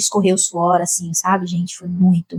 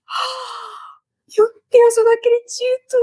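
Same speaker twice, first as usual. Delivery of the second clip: much higher, breathless gasp of joy; starstruck disbelief; much slower than usual. Retold or imitated. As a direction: squeal it like meeting your idol. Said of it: "Eu fiquei tão emocionada".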